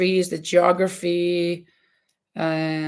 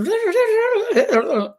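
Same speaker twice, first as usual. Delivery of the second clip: high trilled babble, falling off; long high rolling gibberish noise; imitated noise; 13 semitones higher, 4 dB louder than usual. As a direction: mimic a child's nonstop noise-making. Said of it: "making noises".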